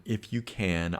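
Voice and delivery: ordinary talk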